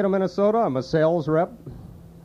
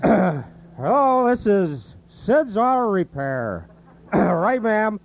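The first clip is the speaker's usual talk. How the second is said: Sid running an auto repair shop; high rambling Sid voice; Sid Hartman impression; 5 semitones higher than usual. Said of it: "it dawns on you that you can do Sid".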